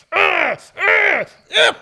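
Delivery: high, loud effortful grunts; straining grunts. Retold or imitated. Retelling smearing his shoulder on the roller, loud high straining grunts. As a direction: grunt hard as you force the shoulder into the wall.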